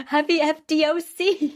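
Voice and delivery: high, loud acted greeting; mock cheery greeting.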